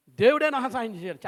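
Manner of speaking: preacher mimics Jacob's smug lie to his father; Jacob's sly claim. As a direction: play Jacob boasting that God helped him. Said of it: "ఈయన ఏమంటాడంటే".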